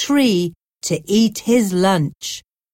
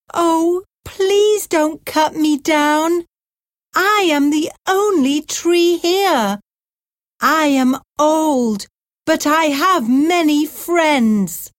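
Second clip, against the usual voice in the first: much higher, pleading voice; sad old tree; 8 semitones higher than usual. A narrator's reading. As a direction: beg the man to spare you. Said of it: "The tree was sad and said".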